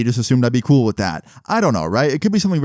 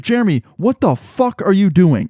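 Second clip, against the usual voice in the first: confronting yell; 7 semitones higher than usual. Retold or imitated. Confronting Jeremy caught at his PC, a retold angry callout. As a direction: call him out as you spin his chair around.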